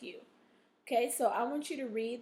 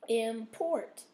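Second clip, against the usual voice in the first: "Import" split slowly, weight on PORT; stress on second syllable; 4 dB louder than usual. Shown as a demonstration. Teacher showing where the stress is, "Import" stressed on PORT. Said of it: "O R is in the second syllable".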